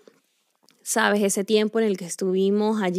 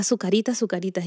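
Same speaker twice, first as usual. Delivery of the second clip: ordinary talk, nothing marked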